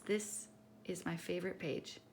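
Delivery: plain reading voice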